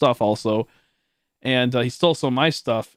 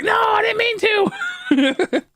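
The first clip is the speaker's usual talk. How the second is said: very high, slow, squealing through laughter; laughing mimicry; 4 dB louder, much slower than usual. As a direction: squeal the quote while cracking up. Laughing while mimicking kid.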